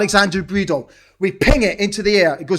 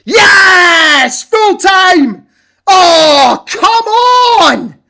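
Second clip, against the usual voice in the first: screaming with joy, much higher and louder, voice cracking; ecstatic shouting; 10 semitones higher, 8 dB louder, much slower than usual. Real, not acted. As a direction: roar it out at the final whistle. Late winner, full time whistle.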